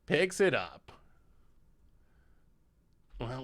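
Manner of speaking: ordinary talk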